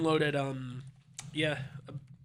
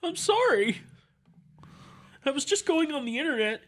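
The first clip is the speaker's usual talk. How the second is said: much higher, whiny nerd apology; high, nerdy whine; 10 semitones higher than usual. Acted. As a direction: squeak it out like a bullied kid. Nerd voice then bully.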